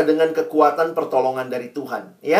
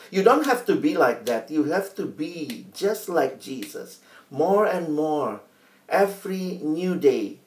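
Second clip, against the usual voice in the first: slow, soft English exhortation; slow solemn urging; 4 dB quieter, slower than usual. Acted. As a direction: speak softly and tenderly.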